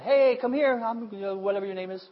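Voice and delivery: high, loud offhand shout of "hey, come here"; careless call. Retold or imitated. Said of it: "it's not just going".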